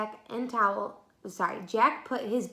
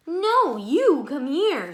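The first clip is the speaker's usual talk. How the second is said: higher, louder, slow call from far away; distant call; 5 semitones higher, 9 dB louder, much slower than usual. Acted. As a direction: sound as if shouting from the end of a tunnel. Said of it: "Her voice sounded far away".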